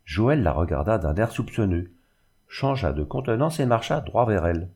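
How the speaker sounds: ordinary delivery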